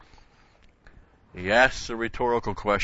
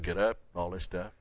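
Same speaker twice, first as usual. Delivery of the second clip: ordinary talk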